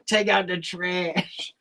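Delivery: put-on soft, slow, eerie old-woman mutter; old smoker woman. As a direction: rasp it out slowly like an old lady who smokes. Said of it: "Sounds like an old woman with a smoking problem".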